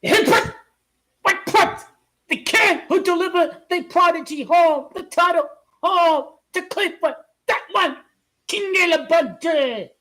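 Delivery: high, loud hype call; hyped calling. Real, not acted. Fan hyping a quarterback to his viewers, loud.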